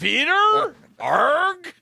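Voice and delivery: very high, loud pirate shriek; Dodgeball movie pirate. Acted. Co-host repeats his Dodgeball bit, very high hammed-up pirate cry. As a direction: push the mock pirate cry way up. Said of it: "now he's doing dodgeball clips".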